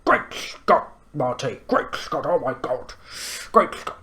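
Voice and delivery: a light imitation of Doc Brown's alarmed exclaiming; a hint of a flustered mad scientist. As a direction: lightly play the frantic old inventor. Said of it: "Doc Brown goes".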